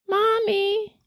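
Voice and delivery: put-on high eerie little-girl voice; a small voice calling Mommy in the dark; ghostly child's call. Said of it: "as clear as day".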